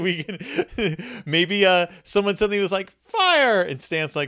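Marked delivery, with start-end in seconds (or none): 2.9-3.6 high, loud yell of fire; shouted alarm. Retold or imitated. Bystander yelling a warning to the crowd. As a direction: cry out the alarm.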